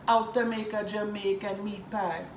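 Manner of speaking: hammed-up Jamaican accent greeting; playful Jamaican accent welcome. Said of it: "That was a good impression".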